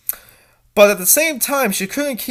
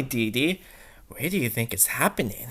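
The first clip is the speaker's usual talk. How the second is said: usual speaking voice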